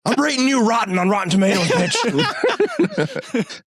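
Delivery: very high pretend threat with laughter; laughing mock outrage, shooting up at the end; mock tantrum. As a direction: squeal it as a petty, hammed-up revenge. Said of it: "lose your temper one day".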